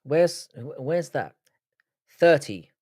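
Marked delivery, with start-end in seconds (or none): 2.2-2.7 "Thirty" with a clear th; careful th. Shown as a demonstration. London streamer showing chat how they say it, careful th. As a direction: enunciate the th in "Thirty". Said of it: "how I say thirty".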